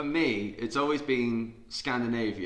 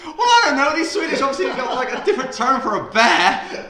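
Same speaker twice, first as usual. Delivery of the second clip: laughing guess; 12 semitones higher, 8 dB louder than usual. Real, not acted.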